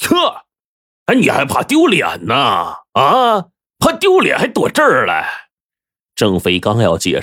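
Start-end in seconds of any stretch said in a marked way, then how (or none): 0.1-5.5 high scornful scoff, then jeering questions; sneering. A narrator's reading. Gang leader mocks the debtor for fearing shame, sneering. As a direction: jeer at him in a raised, high pitch. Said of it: "这刀哥冷笑了一声".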